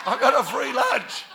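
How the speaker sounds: high, loud comic crowing over the laughter; exultant punchline. Real, not acted. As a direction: crow the punchline out to the crowd. Repeats punchline loudly over laughter.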